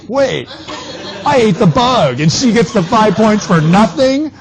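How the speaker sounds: high, loud mock outrage; imitated pissed-off protest. Classmates protest her unearned points, high mock-indignant outburst. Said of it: "was really pissed 'cause they were like".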